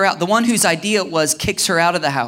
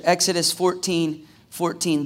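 normal talking voice

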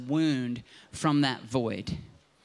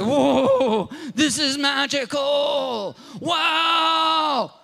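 much higher, louder awestruck gasp; a five-year-old hearing magic for the first time; high, loud, slow wow of amazement; childlike wonder; 8 semitones higher, 5 dB louder, much slower than usual. Retold or imitated. Kid amazed strumming guitar.

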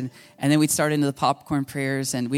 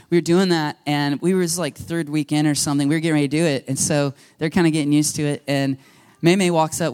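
ordinary delivery

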